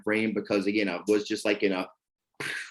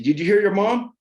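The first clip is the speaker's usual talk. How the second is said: sharp angry demand, higher and louder; aggressive; 9 semitones higher, 6 dB louder than usual. Retold or imitated. Father yelling at his stepson, aggressive. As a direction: snap at the stepson to get moving. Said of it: "I was very, like, aggressive".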